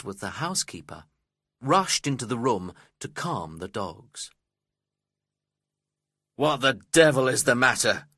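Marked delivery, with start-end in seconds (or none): 6.3-8.1 loud, irritated demand; brusque, raised-voice question; rude. A narrator's reading. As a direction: demand it rudely, with force. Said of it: "Mister Heathcliff asked me rudely".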